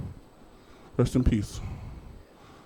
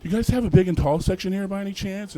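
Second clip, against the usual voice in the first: innocent polite ask; 6 semitones higher than usual. Retold or imitated. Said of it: "they're like".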